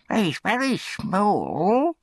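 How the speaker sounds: high, drawn-out appraisal; very high voice, slowly sizing up the boy; disapproving undertaker's wife. A narrator's reading. Undertaker's wife sizes up the workhouse boy, high disapproving voice.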